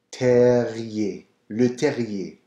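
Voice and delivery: terrier with a rolled French R, ending in ye. Shown as a demonstration. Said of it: "there's the roll of the R".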